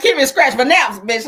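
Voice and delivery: loud, sassy quip to her friend; retold attitude-laden aside. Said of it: "I had to be like".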